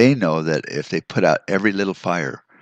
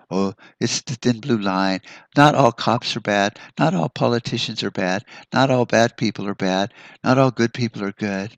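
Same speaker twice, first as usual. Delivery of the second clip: mocking quote; 5 semitones higher than usual. Mocking defenders of cops.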